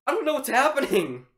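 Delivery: high, loud frightened babble; rattled, overwhelmed. Real, not acted. Player who doesn't know what's happening, rattled. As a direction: blurt it out high, in a fluster. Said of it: "I can't even talk".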